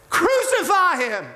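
high, loud, drawn-out yell; crowd shout. Acted. Crowd demanding death from Pilate, shouting. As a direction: shout it like an angry mob. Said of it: "They shouted".

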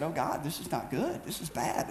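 soft, low, muttered to himself in the mirror; quietly horrified at the bump; hushed dread. Retold or imitated. To himself in the bathroom mirror at dawn, hushed dread.

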